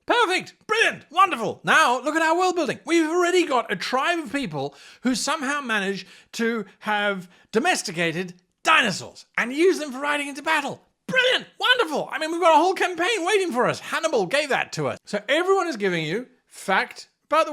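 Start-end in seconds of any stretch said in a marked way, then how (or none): 0.1-1.6 high, loud bursts of praise; excited praise. Acted. Host cheering a player's dinosaur idea with excitement. 11.1-13.8 excited. Acted. Host thrilled by world building.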